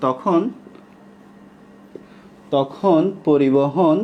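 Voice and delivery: word by word, leaving time to write; slow dictation. Shown as a demonstration. Teacher dictates a line for students to write, slow dictation. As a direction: dictate very slowly, long pauses between chunks. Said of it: "আমি একদম আস্তে আস্তে বলতেছি".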